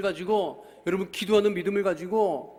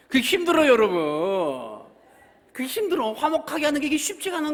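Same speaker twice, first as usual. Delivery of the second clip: emphatic plea; 8 semitones higher, 7 dB louder than usual. Real, not acted. Preacher stresses difficulty.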